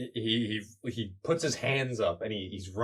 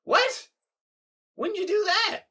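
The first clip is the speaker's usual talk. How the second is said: extremely high, loud squeal of shock; shocked disbelief; 6 dB louder than usual. Acted. Crab hero hears the rat ate his mom, extremely high shock. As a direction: shriek it in total disbelief.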